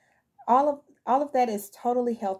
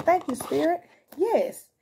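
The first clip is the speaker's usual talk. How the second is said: a sudden thrilled yes, as if the cards just agreed; excited thanks; 7 semitones higher, 4 dB louder, slower than usual. Real, not acted. Reader thanking Spirit for a confirming card, excited. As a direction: pitch jumps up, higher and louder.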